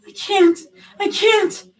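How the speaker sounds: high, loud frightened refusal; crying panic; panicked refusal. A narrator's reading. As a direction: shake off the help.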